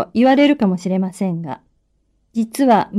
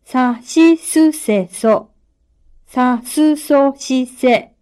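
dictating; slower than usual. Shown as a demonstration. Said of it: "発音を聞いて、かなを使って書き取ってください".

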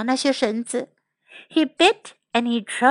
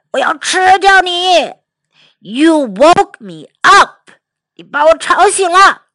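loud, angry lion voice; roaring lion; 3 semitones higher, 6 dB louder than usual. Acted. Lion scolds the mouse who woke him, roaring. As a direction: roar it at a tiny mouse. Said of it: "狮子咆哮道".

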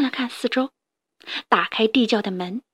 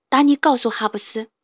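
plain reading voice

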